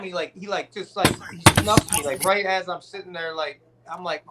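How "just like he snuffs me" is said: ordinary delivery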